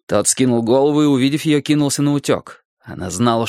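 usual speaking voice

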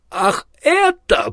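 long, high-pitched drawn-out exclamation. A narrator's reading. Rincewind reacts to news of a horrible death, drawn out and high. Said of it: "протянул Ринсвинд".

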